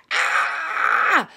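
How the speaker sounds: very loud, high cathartic yell; scream. Shown as a demonstration. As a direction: show them how to scream it all out. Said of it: "you just go".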